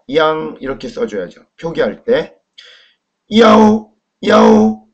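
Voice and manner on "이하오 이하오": 이하오 held high and loud as one long syllable; teacher sounding out 이하오, loud, high and drawn out; syllable demonstration. Shown as a demonstration. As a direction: model 이하오 slowly on a high, level, ringing note. Teacher models pinyin syllable twice.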